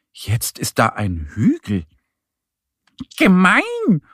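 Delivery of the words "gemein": high, loud little duck cry; high-pitched, loud exclamation; indignant call. A narrator's reading. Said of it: "ruft Annie und".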